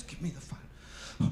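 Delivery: slow, soft, muttered; grumbling dad. Retold or imitated. Father grudgingly asking his son for the phone, slow and soft.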